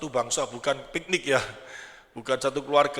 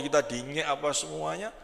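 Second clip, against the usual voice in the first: usual speaking voice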